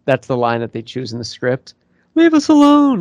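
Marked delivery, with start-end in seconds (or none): 2.2-3.0 high quote voice. Retold or imitated. Quoting movie line.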